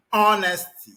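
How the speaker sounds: Honesty said ON-esty, loud and clear; silent H honesty. Shown as a demonstration. Teacher modelling the word for pupils. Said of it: "You say honesty".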